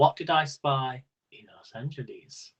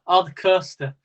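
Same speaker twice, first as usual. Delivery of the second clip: ordinary talk, nothing marked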